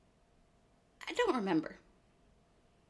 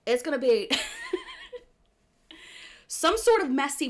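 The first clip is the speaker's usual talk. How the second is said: laughing; 9 semitones higher, 3 dB louder than usual. Real, not acted. Laughing while describing her look.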